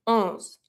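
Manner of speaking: Onze ending on z, E silent; mute final E. Shown as a demonstration. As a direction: stop Onze at the z sound. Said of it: "Final E, no accent, mute".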